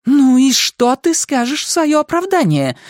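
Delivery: high-pitched woman demanding an explanation; angry cry. A narrator's reading. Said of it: "выкрикнула Мэгги".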